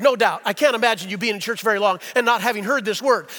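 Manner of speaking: ordinary talk, nothing marked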